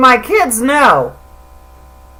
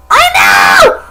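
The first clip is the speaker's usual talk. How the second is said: yelled exclamation; 4 dB louder than usual. Real, not acted. Sudden loud exclamation.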